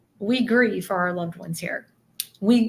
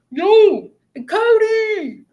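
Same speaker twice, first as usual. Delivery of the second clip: much higher and louder yell of a name; calling out to buddy; 13 semitones higher, 8 dB louder, much slower than usual. Retold or imitated. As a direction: shout across to your friend. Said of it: "he'd be like".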